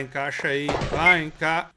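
usual speaking voice

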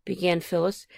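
ordinary delivery

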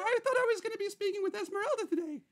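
high put-on diva voice. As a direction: speak up high as a puzzled star guest.

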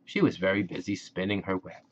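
ordinary delivery, nothing marked

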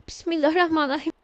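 ordinary talk, nothing marked

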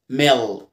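"ميلك" said "melk" with a dark l. Shown as a demonstration. Teacher has learners watch the L, "ميلك" as melk. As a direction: hold the dark l in "ميلك" before the k. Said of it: "بص كده".

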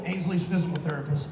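ordinary delivery

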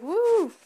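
high, loud "woo"; mock cheering. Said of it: "you're over there just like".

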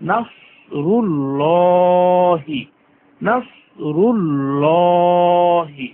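নাসরুল্লাহি with thick heavy s and full lam, drawn out; heavy sad. Shown as a demonstration. Teacher modelling heavy letters on নাসরুল্লাহি. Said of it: "লফজে আল্লাহ মোটা।".

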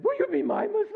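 very high, loud comic squeal; hammed-up excited woman; mock eager plea. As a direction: plead in an over-the-top high squeal. Acts eager woman begging for friend.

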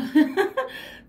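high, delighted laugh. Real, not acted. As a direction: burst out laughing, overwhelmed. Overwhelmed by love in reading.

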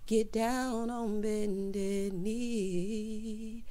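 extremely slow and soft; slow, warning drawl; drawn out. Real, not acted. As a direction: stretch every word out. Warning her viewers.

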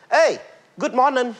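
high bright good morning, bent mouth; bent-mouth English. Shown as a demonstration. Host showing the crowd how to bend into English. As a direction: twist your mouth into English. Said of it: "chịu khó bẻ miệng".

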